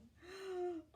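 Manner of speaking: sharp high gasp of alarm; frightened gasp. Acted. Bird dives at Oscar.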